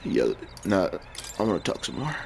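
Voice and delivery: a soft low male response; quiet low reply. Acted. Switches to the male character.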